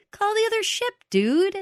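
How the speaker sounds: high, insistent mock yell at the screen; exasperated viewer outcry. Viewer urging the characters to radio the ship, exasperated outcry. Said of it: "every single one of us was like".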